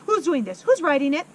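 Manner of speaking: loud, shaken demand; angry yell. Acted. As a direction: yell at the whole room. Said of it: "she yelled at nobody".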